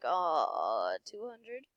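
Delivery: ordinary talk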